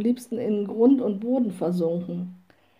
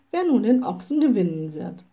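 ordinary delivery

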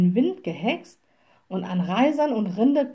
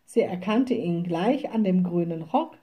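plain reading voice

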